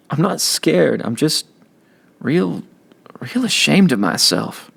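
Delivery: high, choked start; tearful plea. A narrator's reading. Kenny crying to Byron about the church. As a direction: reach out through tears.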